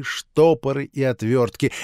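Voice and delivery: ordinary delivery, nothing marked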